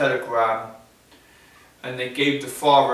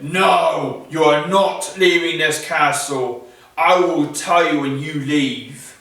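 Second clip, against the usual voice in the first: stern beast; 3 semitones higher, 7 dB louder than usual. Acted. Beast refuses to let Bella go.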